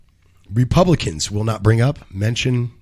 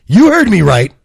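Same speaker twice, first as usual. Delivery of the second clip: dramatic emphasis; 7 semitones higher, 9 dB louder than usual. Acted. Dramatic news read after pause.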